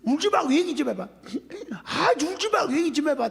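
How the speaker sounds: high-pitched, exasperated pleading. Retold or imitated. Pastor urging a crying woman to talk, exasperated. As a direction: re-enact losing patience with someone crying. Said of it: "답답해 죽겠네".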